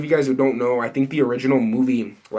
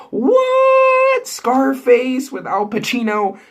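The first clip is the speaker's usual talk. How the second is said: a loud, very high shriek of disbelief; shocked; 12 semitones higher, 5 dB louder, much slower than usual. Real, not acted. Hearing Scarface will be remade, shocked shriek. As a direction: shriek it like you can't believe it.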